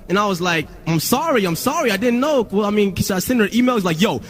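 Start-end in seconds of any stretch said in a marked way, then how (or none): 0.9-2.5 high, pleading apology; hurried-to-appease mock meekness; cowed past self. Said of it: "I'm a pussy at first". 4.0-4.2 high, forceful scolding; hammed-up urgent commands; bossy label guy. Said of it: "This is what he was saying".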